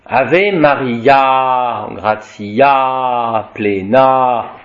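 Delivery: "Ave Maria, gratia plena" very slow, final A held; slow demo of "gratia plena" with long A. Shown as a demonstration. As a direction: stretch each final A of "gratia plena" like a chant. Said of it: "qui marquent ce A long dans la prononciation".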